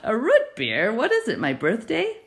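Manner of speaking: loud, delighted disbelieving question; tickled at the idea of a treat; amused surprise. Acted. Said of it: "She laughed".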